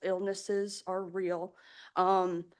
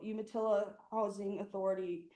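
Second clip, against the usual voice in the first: normal talking voice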